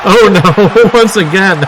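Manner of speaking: can't help laughing at the carnage; laughing dismay. Real, not acted. Commentator laughing as the wrestler gets beaten again, amused dismay.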